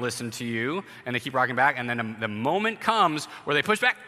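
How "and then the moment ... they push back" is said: ordinary talk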